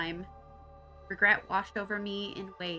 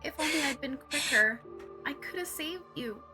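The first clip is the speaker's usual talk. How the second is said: tearful regret; 5 semitones higher than usual. Acted. Mourning dead friend.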